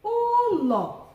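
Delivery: Ulo pitched high and drawn out; teacher sounding a homework word; high Igbo word. Shown as a demonstration. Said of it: "I'll sound them".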